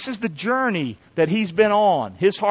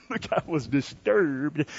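hushed aside; 6 semitones lower, 9 dB quieter, slower than usual. Acted. Preacher drops voice for effect.

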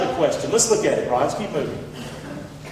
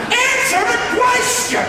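much higher, slow, yelled demand; exasperated shout; 13 semitones higher than usual. Acted. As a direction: yell it at someone dodging. Exasperated at question-dodger.

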